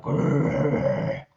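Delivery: loud mock growl, rolling the r. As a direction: growl at them like an animal. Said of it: "And I'm like".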